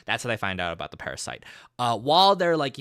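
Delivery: ordinary talk, nothing marked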